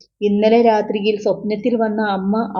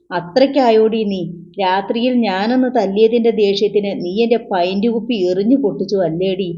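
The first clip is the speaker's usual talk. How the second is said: usual speaking voice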